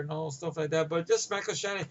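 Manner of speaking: ordinary talk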